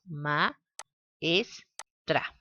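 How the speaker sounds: Ma-es-tra said syllable by syllable; syllables split apart. Shown as a demonstration. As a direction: break Ma-es-tra into three separate beats. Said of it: "Si separamos". (separar Ma-es-tra sílaba por sílaba)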